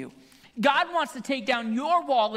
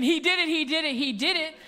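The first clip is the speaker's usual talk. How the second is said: raised, insistent accusation; half-hearted retold scream, pitched up; mock screaming; 4 semitones higher than usual. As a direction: repeat it like an urgent tattletale. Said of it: "Here I am screaming".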